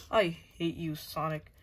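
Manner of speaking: ordinary talk, nothing marked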